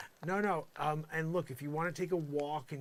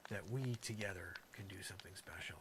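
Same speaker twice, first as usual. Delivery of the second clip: whisper; 7 semitones lower, 8 dB quieter than usual. Acted. Musing to himself, whispered.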